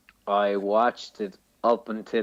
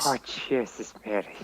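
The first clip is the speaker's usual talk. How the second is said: imitating chatty old woman; 4 dB quieter than usual. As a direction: mimic the gasping woman behind you in the cinema. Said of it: "Two fifty-something-year-old women".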